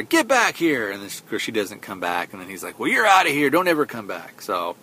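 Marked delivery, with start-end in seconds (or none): 0.0-0.9 high-pitched mock yell; retold yell. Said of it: "and then he yells at her". 2.8-4.4 high, louder mock outburst, easing off; retold angry yell. Retold conductor yelling after her.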